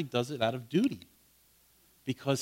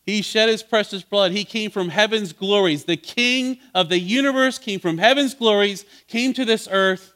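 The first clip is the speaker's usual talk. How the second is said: louder, higher, driving preacher's cadence; preaching at full force; 6 semitones higher, 7 dB louder than usual. Acted. Preacher exhorting his congregation about Christ's sacrifice, at full force. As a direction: build it up like a fiery pulpit climax. Climax of the sermon.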